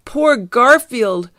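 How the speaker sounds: high, loud, drawn-out cry of pity; cried lament. Acted. Fieldgar sees Garfield blasted, cried lament. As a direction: wail it slowly for a friend. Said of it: "cried Fieldgar".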